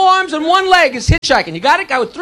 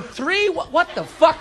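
quiet, baffled muttering; puzzled audience member; 5 semitones higher, 7 dB quieter, slower than usual. Retold or imitated. Audience member walking out still puzzling over joke, baffled and quiet. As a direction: work it out slowly under the breath, confused. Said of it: "you see 'em walking out going".

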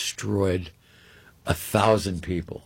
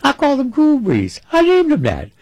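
high, loud raspy Cotton impression; imitating Cotton; 7 dB louder than usual. Storyteller mimicking Cotton, amazed at the dinner invite, high and loud. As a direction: voice Cotton's delighted disbelief, much higher. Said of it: "He goes".

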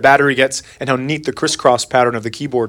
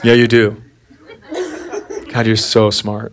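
sarcastic; 3 semitones lower, slower than usual. Retold or imitated. Dad's sarcastic reply.

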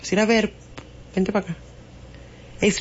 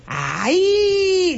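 mock wail; 11 semitones higher than usual. Mock complaint about being scolded.

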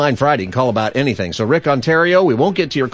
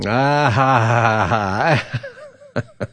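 drawn-out laugh at a punchline; spaced "ah, ha, ha" laugh; much slower than usual. Real, not acted. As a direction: laugh slowly at a joke. Host reacts to the joke's punchline.

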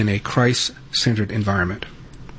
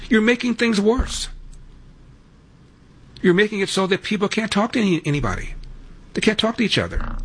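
exasperated scolding; 10 semitones higher than usual. Real, not acted. Host scolding a prank caller.

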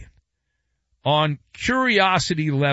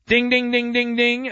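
high sung ding ding like a game-show bell; quiz-show bell; 7 semitones higher than usual. Pretend. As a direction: ring out the correct answer chime.

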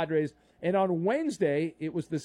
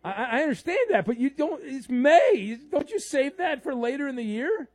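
high, raised-voice objection; exasperated; 8 semitones higher, 4 dB louder than usual. Real, not acted. Host scolds caller for yelling in May, exasperated. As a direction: argue back in pitched-up disbelief.

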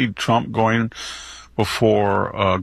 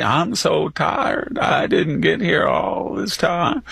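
slow, higher, drawn-out mock Southern; imitating Hillary's slow fake drawl; mocking Hillary's put-on accent; 6 semitones higher, slower than usual. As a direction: drag it out in a fake twang.

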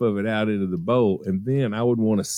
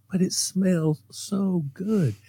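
put-on dreamy child; 9 semitones higher, slower than usual. Child self pleading, dreamy.

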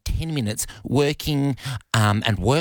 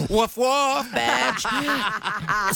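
mock dog bark; 12 semitones higher, much slower than usual. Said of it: "Where's Bluey?".

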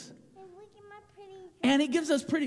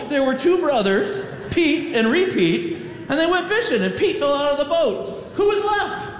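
higher with each retelling, breaking into laughs; giddy looping joke; 8 semitones higher, 4 dB louder than usual. Acted. Pastor teasing the children with an endless joke, giddy and laughing. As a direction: keep the joke spinning, laughing harder each lap.

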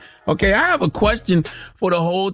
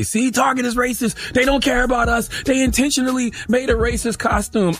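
high, indignant mocking voice; mock outraged critic; 10 semitones higher than usual. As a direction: mimic an outraged crowd. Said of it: "People say".

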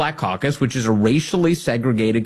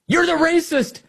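mock accuser.

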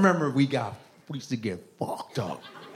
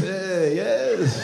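mock Middle Eastern tough guy; 7 semitones higher than usual. Acting a stern Middle Eastern man.

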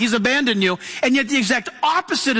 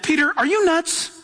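higher-pitched disbelief, lightly acted; incredulous counselor; 6 semitones higher than usual. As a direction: question his sanity as a worried friend. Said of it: "The counselors could have said".

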